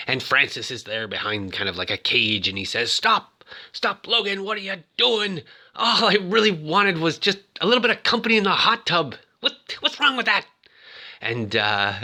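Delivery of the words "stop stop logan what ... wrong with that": high, aggrieved imitated plea; hammed-up wounded protest; mock protest. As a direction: plead in a comically raised voice. Voicing Francis calling from behind cage.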